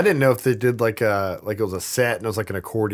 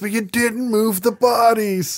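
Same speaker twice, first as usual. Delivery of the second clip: high, slow mock rant at the boss; slow, high-pitched outraged imitation; angry Steve; 11 semitones higher, much slower than usual. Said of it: "He's like".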